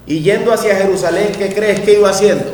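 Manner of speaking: loud, fast sermon build; preaching at full force. Real, not acted. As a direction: drive the question home to the congregation. (predicando con fuerza)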